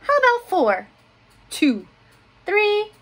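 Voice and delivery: high, loud eager haggling; bargaining little girl. A narrator's reading. Olivia bargaining over bedtime books.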